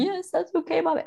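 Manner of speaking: put-on high little-kid voice answering mommy; mother imitating her child agreeing; child's voice. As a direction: pipe up sweetly like a small child.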